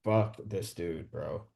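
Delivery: low, slow, quiet cursing; shaken disgust. Retold or imitated. Really shaken after being lied to, low disgusted muttering. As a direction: mutter it low and drawn out, fed up. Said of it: "that's when I was, like, really shaken".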